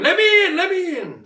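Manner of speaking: high-pitched mock scream, easing off on the repeat; high, urgent begging to get inside; retold screaming. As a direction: act out a frantic plea at the door. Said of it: "So I was screaming".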